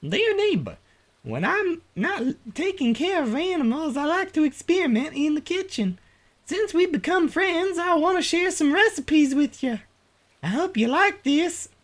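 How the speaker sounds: very high, put-on neighbor voice; friendly neighbor character. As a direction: read the letter in a sweet high-pitched character voice. Voices a game letter.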